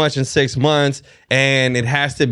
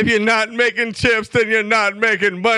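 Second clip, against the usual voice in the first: high, belted rock-star delivery of the sign-off; sing-shout it like a rock frontman; 8 semitones higher than usual. Pretend. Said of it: "In my rock voice".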